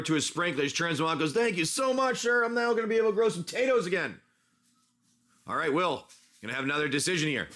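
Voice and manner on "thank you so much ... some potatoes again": higher-pitched, lightly acted farmer voice; grateful potato farmer. Farmer thanks his helper for the water, grateful and higher-pitched. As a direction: gush thanks in a raised pitch. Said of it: "Your tato farmer goes running over".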